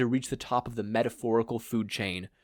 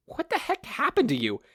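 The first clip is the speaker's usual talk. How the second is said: high, startled and exasperated; a much higher voice, thrown off balance; startled woman voice. Acted. Inko asking what happened to her, high and startled.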